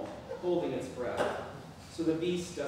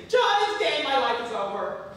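much higher, loud mock hysterical wail; hysterical screaming; 12 semitones higher, 11 dB louder than usual. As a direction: shriek it like a melodrama queen. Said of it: "starts pulling at her hair and screaming".

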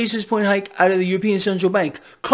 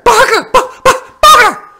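mock barking yell; 13 semitones higher, 10 dB louder than usual. Vlogger mocking the Fed president, barking the name out loud. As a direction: yell the name like a barking dog, much higher and louder.

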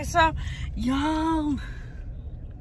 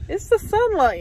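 insistent; 8 semitones higher, 4 dB louder than usual. Real, not acted. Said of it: "third take, higher and louder".

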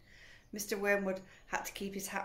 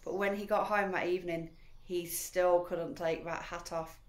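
plain reading voice

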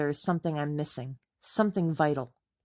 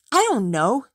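higher, louder curt snap; snapping; 6 semitones higher, 7 dB louder than usual. Acted. Tom snaps at Rachel, fed up with her questions. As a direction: snap it back, irritated. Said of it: "he snaps".

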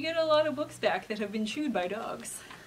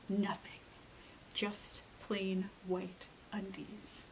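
hushed whisper, slow and tense; 5 semitones lower, 11 dB quieter, much slower than usual. A narrator's reading. Narrator hushing the kids during the tense search, whispering. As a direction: breathe it so as not to wake anything.

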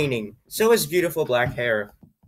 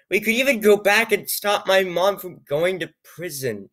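plain reading voice

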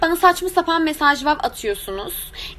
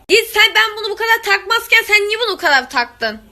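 higher and louder, indignant; 7 semitones higher, 4 dB louder than usual. Real, not acted. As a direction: demand why they care. Dişine yorum yapanlara çıkış. (yüksek sesle, sitemli)

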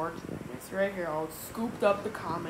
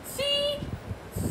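George. Acted. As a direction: pitch it way up for George. George voiced high above narration.

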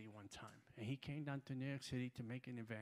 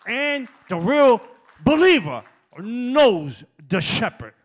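loud, high, impassioned sermon climax; shouting the Psalm from the pulpit; full-force preaching; 4 semitones higher, 22 dB louder than usual. Acted. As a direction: whoop and thunder at the congregation.

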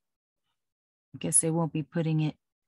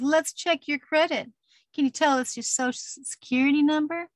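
much higher, chirpy salesperson voice; imitating car dealer; 9 semitones higher, 3 dB louder than usual. Dealer greeting a car buyer, a mock chirpy salesperson. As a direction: play the eager salesman asking for numbers.

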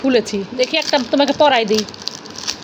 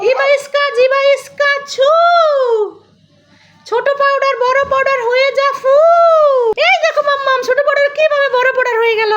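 put-on higher childlike voice chanting nonsense words; mock magic spell; 9 semitones higher than usual. Little character casts a spell for mummy, playfully. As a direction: cast a silly spell like a little wizard.

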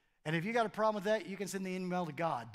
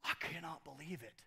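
mock shock; 5 semitones lower, 11 dB quieter than usual. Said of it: "you and I are like".

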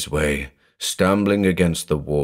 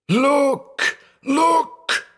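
very high, strangled cry; stifled cries. A narrator's reading. Brother gripping his throat, stifled cries. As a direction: squeeze out a desperate high cry. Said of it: "stifling cries of".

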